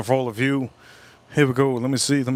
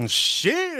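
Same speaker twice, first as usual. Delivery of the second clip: delighted squeak. Real, not acted. Tasting food, delighted.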